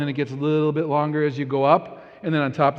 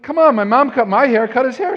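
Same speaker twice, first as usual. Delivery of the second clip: pleading insistence; 9 semitones higher, 9 dB louder than usual. Retold or imitated. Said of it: "I'm like".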